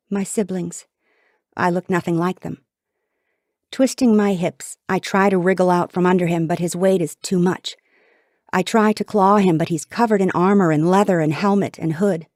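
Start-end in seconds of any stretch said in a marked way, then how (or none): none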